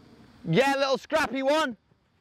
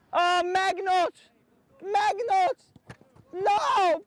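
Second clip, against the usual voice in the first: high, drawn-out cry of dismay; dismayed shout; 9 semitones higher, much slower than usual. Real, not acted. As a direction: cry out as the chance goes. Coach reacting to a miss.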